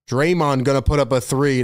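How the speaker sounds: ordinary talk, nothing marked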